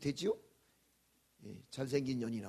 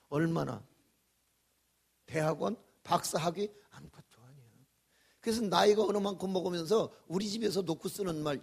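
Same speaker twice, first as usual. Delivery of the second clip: normal talking voice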